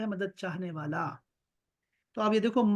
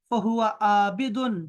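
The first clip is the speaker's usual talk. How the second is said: فہو عابدن stretched out very slowly; slow Arabic citation; 3 dB louder, much slower than usual. Shown as a demonstration. Teacher modelling the doer form for Talha, very slow. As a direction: draw out فہو عابدن like a recitation. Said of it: "drawn-out Arabic model form".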